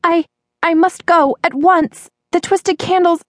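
put-on high, urgent girl's voice; high-pitched anxious exclamation; flustered cry. A narrator's reading. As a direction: blurt it out, high and agitated. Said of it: "she cried out".

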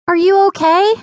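high, rising worried question; concerned call. A narrator's reading. Mia checks on sister after the thud, concerned high call.

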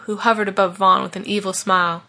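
usual speaking voice

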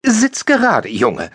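high, sharp mother's command; mother's reproach. Retold or imitated. Mother scolding her son's posture, high and sharp. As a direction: snap at the boy to sit up.

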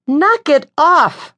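high, loud, slow yell. A narrator's reading. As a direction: shout it up at the unseen attacker. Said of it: "I yelled".

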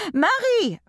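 harsh, high shouting ballet mistress; yelling across the room. A narrator's reading. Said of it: "shouted across the room in her terrible, grating voice".

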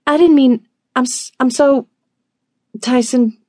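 high, squeaky, stammering apology; flustered apology. A narrator's reading. Flustered apology after spilling the tea.

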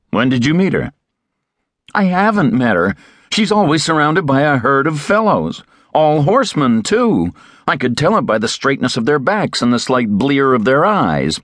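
put-on eager young Terry. A narrator's reading. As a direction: press the question, young and keen.

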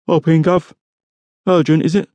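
high-pitched driver's voice, casual; casual copper, voice lifted high; offhand police driver. A narrator's reading. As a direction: play the driver, pitched well above the narration. Driver's voice in narrated story.

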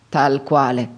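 understated, final-sounding reply; subdued confirmation. A narrator's reading. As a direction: drop the pitch to close the matter. Subdued answer about late father.

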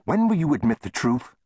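high-pitched, indignant challenge; demanding. A narrator's reading. Shopkeeper pressing the saloon owner, demanding. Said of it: "Chester demanded".

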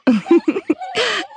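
high amused giggle; light laugh. A narrator's reading. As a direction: giggle delightedly. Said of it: "she laughed".

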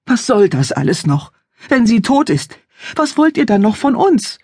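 father in tears demands what they still want; weeping father. Acted. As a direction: voice cracking in grief and anger. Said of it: "dass ihr Vater weinte".

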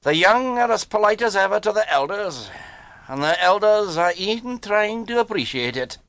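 high-pitched, solemn answer; grave reply. A narrator's reading. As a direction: deliver it with dry gravity. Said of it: "was the grave reply".

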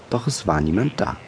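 ordinary delivery

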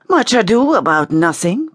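put-on lightly French-accented, high-pitched quip; French-tinged drollery. A narrator's reading. Pierre mocks the gathering.

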